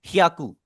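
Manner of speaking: हियाकू run together in one quick go; said quickly. Shown as a demonstration. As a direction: blend hee and aaku swiftly into हियाकू. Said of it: "तेजी से आपने पढ़ना है". (हियाकू को तेजी से, जोड़कर)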